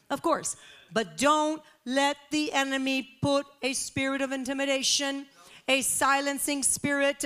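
high, loud rallying exhortation; forceful preaching. Real, not acted. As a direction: preach it with rising conviction. Preaches at full force to congregation.

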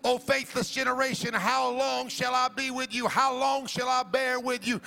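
high, ringing sing-song sermon cadence; preaching at full force. Acted. Pastor urging the congregation to fast, full force. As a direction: chant each bring that line to Jesus.

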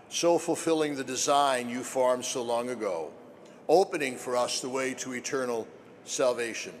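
solemn liturgical prayer voice. Acted.

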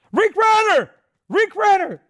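very high, loud squeal of recognition; imitated fan shout. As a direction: mimic an excited fan calling out a name. Imitating fans calling out his name.

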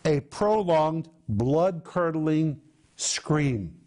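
"blood-curdling scream" drawn out very slowly; slow dramatic emphasis. Shown as a demonstration. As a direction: stretch "blood-curdling" out like a dread. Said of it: "preacher dwells on the scream".

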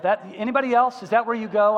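ordinary talk, nothing marked

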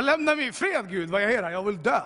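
loud protest, starting high; exasperated outcry. Retold or imitated. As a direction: shout back at God to be left alone. Yelling back at God. (uppgivet, högljutt utrop till Gud)

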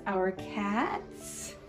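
ordinary talk

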